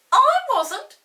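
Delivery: very high, loud denial; indignant denial. Acted. Peter denies to Molly, indignant. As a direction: deny it like a stubborn boy. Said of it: "said Peter".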